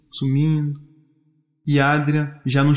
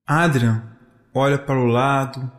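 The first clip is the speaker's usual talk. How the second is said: ordinary delivery, nothing marked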